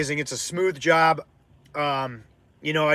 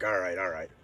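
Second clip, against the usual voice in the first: much lower, softer grumbling surrender; guy giving in; 9 semitones lower, 9 dB quieter than usual. Retold or imitated. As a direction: mumble it like the guy caving. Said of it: "He was like".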